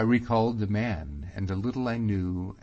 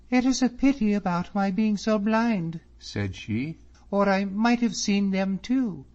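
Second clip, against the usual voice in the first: put-on high, bright-eyed girl's voice, louder; young lady's voice; 13 semitones higher, 6 dB louder than usual. A narrator's reading. Miss Drummond chatting to David about the ladies, high and lively. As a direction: voice her a lot higher than the narrator.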